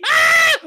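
short, high, loud scream; startled scream. Acted. Player sees bird coming toward him, startled scream. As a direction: yelp loudly in fright.

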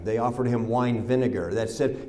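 ordinary talk

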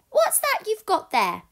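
high, loud curious owlet; baby owl. Acted. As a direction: ask like an eager little bird. Plop the owl speaks.

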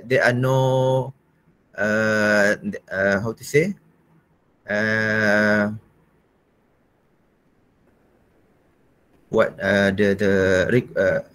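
hesitant, searching for the word; groping for the right word; fumbling for words. Real, not acted. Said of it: "I forgot, I forgot the word".

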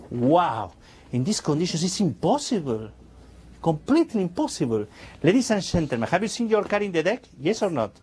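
normal talking voice

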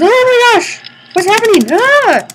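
panicked high yelp; startled scream. Real, not acted. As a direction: shriek in sudden fright. Startled by two blue ones.

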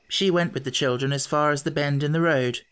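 usual speaking voice

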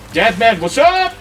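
ordinary talk

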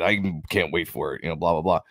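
ordinary talk, nothing marked

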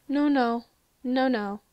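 sharp, loud alarmed no. Real, not acted. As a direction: cry out in alarm. Gasp at danger.

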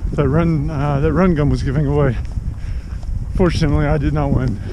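loud, slightly breathless while running. Real, not acted. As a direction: talk over your stride. Talking while doing speed work.

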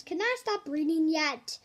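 usual speaking voice

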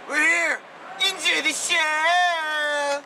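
high, loud, slowed showman announcement; big stagey welcome to the audience; hyped show intro. Acted. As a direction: announce the show like a carnival barker. Opening announcement to camera.

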